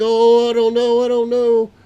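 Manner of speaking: high, loud, slow panicked wail; panicked cry. Retold or imitated. Grandpa panics as the plane fights the crosswind. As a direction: cry out that you don't know. Landing sideways in wind.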